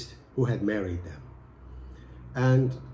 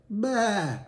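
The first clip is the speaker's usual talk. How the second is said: higher, put-on bleat; goat bleat; 10 semitones higher, 4 dB louder than usual. Wife bleating back at angry husband. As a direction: answer only with a bleat. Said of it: "And she said".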